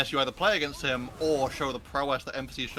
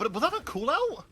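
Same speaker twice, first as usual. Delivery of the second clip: excited; 10 semitones higher than usual. Real, not acted. Caster amazed at play.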